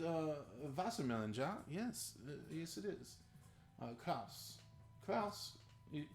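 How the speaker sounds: put-on playful German accent, mostly soft; put-on German accent with German words. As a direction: answer softly as a German character. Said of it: "when I first tried to do a German accent".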